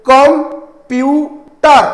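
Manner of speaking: Computer broken into three long, loud beats with pauses between; split into syllables. Shown as a demonstration. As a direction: stretch computer very slowly as com... pu... ter. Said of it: "ତାକୁ ତମେ sound ପାର୍ଟରେ divide କର".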